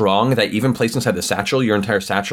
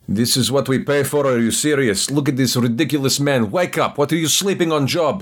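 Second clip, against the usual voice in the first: a touch higher, lightly voiced guard scolding a sleeper; 5 semitones higher than usual. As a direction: chide the sleeping man like an annoyed guard.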